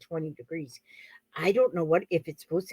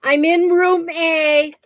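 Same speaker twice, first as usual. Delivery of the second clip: loud, high, drawn-out holler; calling out; 8 semitones higher, 8 dB louder, slower than usual. Real, not acted. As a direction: call to someone in another room. Calling out to daughter elsewhere in house.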